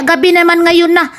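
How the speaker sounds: usual speaking voice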